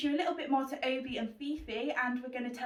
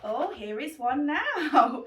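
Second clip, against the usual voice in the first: laughing; 4 semitones higher, 9 dB louder, much slower than usual. Real, not acted. Rabbit appears, laughing.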